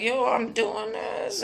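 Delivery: slow, soft, marveling voice; amazed Peter. Retold or imitated. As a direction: breathe it out in quiet wonder. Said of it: "he was like".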